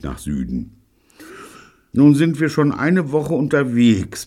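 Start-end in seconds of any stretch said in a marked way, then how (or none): 1.9-4.3 high-pitched grumble; complaining. A narrator's reading. Gordon a week at sea, complaining. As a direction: moan about it, pitched high. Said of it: "beklagte sich Gordon".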